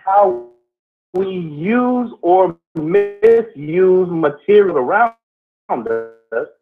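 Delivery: slow, deliberate oration with long pauses; spoken-word pondering. Acted. Co-host expounds on history and time, slow deliberate oration. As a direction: weigh each phrase, pausing heavily between them. Improvised philosophical monologue on history.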